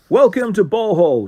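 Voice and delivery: friendly loud welcome; warm shout. Acted. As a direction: call out a cheerful greeting. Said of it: "shouted the tour guide warmly".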